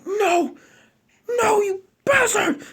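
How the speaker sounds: very high, slow sobbing shout; high wailing outburst; crying rage. Acted. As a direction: break into a cry of grief and rage. Naruto grieving, cursing.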